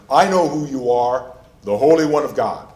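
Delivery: loud, mildly terrified outcry; acted terror. Preacher voicing the demon naming Jesus, mild acted terror. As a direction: call it out loud in mock alarm. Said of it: "There's his terror".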